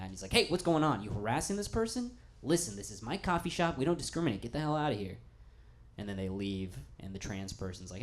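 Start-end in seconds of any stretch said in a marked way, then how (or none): 0.3-5.2 higher and louder, confronting a harasser; mock angry owner. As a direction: play the indignant shop owner throwing someone out. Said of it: "he's mad".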